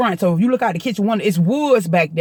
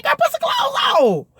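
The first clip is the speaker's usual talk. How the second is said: much higher, far-off yell; mock shout. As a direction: holler at the sister to cover up. Yelling at freaky sister.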